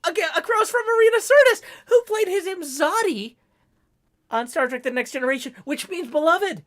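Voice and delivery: high, fast excited rush of trivia. Real, not acted. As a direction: gush about it breathlessly.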